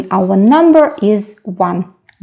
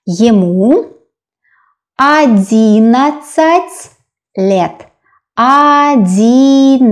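very slow teacher's model of "одиннадцать"; slow sounding-out; 3 semitones higher, slower than usual. Shown as a demonstration. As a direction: stretch every vowel of "одиннадцать". Teacher sounds out new number.

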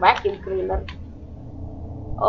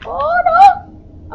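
high shriek; screaming in fear; 6 dB louder than usual. Real, not acted. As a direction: shriek in fright. Jump scare in horror game.

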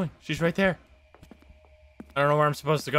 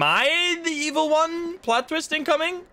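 mock dramatic; 11 semitones higher, slower than usual. Joking about game plot twist.

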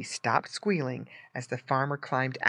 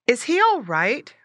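put-on higher, louder child's voice; inquiring girl; 8 semitones higher, 6 dB louder than usual. A narrator's reading. Girl asking about the boxed pig, inquiring. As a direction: ask as a curious little girl. Girl asks after the pet.

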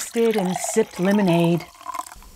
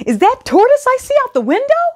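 much higher, louder and fast, like a little mouse; squeaky yell; 4 dB louder, faster than usual. Acted. Mouse calling Hare to the window, squeaky and fast. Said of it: "Mouse yelled".

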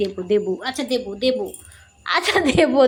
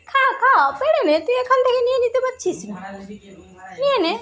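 high, quick pet-coaxing voice; excited urging; 10 semitones higher, faster than usual. Real, not acted. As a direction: egg the dog on, fast and high. Urging dog to grab the snack. (উঁচু গলায় তাড়া দেওয়া)

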